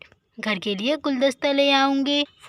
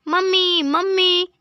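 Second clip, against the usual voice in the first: higher call for mum across the house; 4 semitones higher, slower than usual. Acted. Calling mother on arrival.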